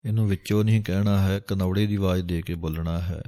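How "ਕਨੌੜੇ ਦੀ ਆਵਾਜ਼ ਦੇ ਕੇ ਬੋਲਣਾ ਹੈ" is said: ordinary delivery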